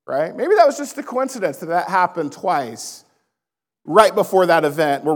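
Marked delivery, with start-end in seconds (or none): 0.3-1.6 higher-pitched, teasing sarcasm; mock doubt. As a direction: toss it out as a doubter's feeble excuse. Repeats mock doubt, rebutting it.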